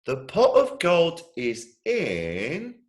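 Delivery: high, slow, hushed build-up; dramatic child reveal. Acted. Little brother revealing the treasure spot, slow high child voice. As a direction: draw it out like a big secret.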